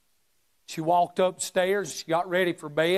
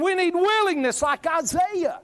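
high, ringing preacher cry; full-force preaching peak; 12 semitones higher, 3 dB louder than usual. Acted. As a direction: lift the line like a revival call.

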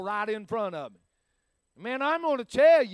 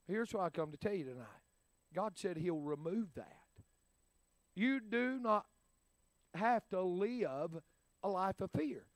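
intimate preaching; 4 semitones lower, 10 dB quieter than usual. Real, not acted. Quiet intense preaching.

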